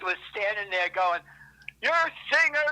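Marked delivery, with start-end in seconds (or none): 1.8-2.6 high-pitched imitated heckling shout; heckler's yell. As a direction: yell it at the band like a jeering heckler. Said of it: "he's yelling all this shit".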